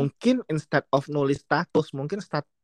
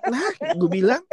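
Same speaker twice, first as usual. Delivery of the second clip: ordinary talk, nothing marked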